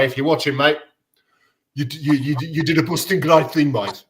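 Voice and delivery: put-on light Birmingham accent; lightly voiced Brummie accent; Brummy accent. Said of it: "That was my Brummy accent impression".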